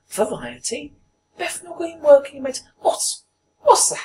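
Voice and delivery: put-on high-pitched, puzzled old woman muttering to herself; put-on elderly woman's voice, very high; old lady. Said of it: "an old lady, at least eighty years old".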